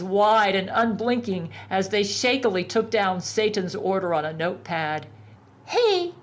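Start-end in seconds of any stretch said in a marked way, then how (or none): 5.7-6.1 high, slow nasal whine; nasal complaint. A narrator's reading. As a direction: whine it through the nose. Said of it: "a nasally voice complained".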